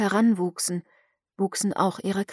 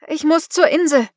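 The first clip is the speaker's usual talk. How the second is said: urgent plea; 7 semitones higher, 6 dB louder than usual. A narrator's reading. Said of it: "sagte Miranda auf Englisch".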